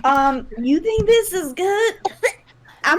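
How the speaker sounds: half-crying laugh; laughing in disgust. Real, not acted. Disgust while washing mouth.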